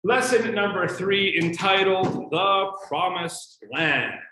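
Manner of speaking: loud, high showman's welcome; host opening. Acted. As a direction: announce it like a TV host kicking off the show. Opens class like show host.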